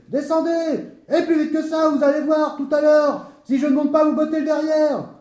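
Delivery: high, loud shouted threats; raging official, pitched high; mad fury. Acted. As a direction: rant furiously at the workmen. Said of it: "parut frapper de folie furieuse".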